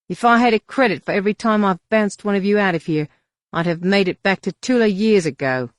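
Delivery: loud, weary alien grumble; grumbling alien bouncer. Acted. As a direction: grouse like a tired bouncer.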